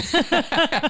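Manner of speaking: falsetto evil laugh; shrill mocking laugh; high mocking cackle. Guide cackling at the thief's mistake. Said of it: "the guide starts laughing".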